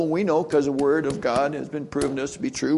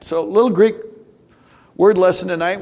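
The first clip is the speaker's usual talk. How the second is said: ordinary talk, nothing marked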